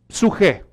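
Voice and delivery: put-on psuje said as SOO-kheh, old Greek way; psuje, ancient Greek pronunciation. Shown as a demonstration. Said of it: "estoy usando la pronunciación del griego antiguo".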